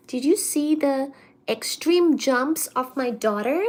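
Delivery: ordinary delivery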